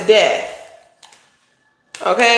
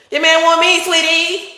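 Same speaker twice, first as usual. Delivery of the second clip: higher, mocking and sing-song; sassy taunt; 7 semitones higher than usual. Taunting a rival about her man, higher and mocking. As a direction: rub it in sweetly, pitched up.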